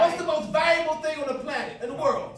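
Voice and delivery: loud, high rallying question; shouted question. Real, not acted. Teacher shouting the question to his class. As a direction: rouse the room.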